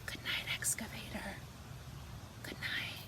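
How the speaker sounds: soft unvoiced bedtime whisper; whispered good night. A narrator's reading. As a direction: whisper goodbye to the sleeping truck. Bedtime story, whispered goodnight.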